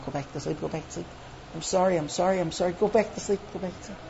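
soft, repeated soothing plea; hushed apology. Acted. Wife lowering the volume for her husband, hushed apology. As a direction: murmur him back to sleep.

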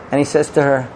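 plain reading voice

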